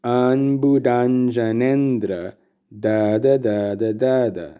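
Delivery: "Ambudanjanendra" drawn out slowly in long and short syllables; demonstrated cadence. Shown as a demonstration. Teacher showing the cadence of this word, "Ambudanjanendra" in measured syllable lengths. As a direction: chant the rhythm of "Ambudanjanendra", then echo it on "da". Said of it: "the cadence of this word".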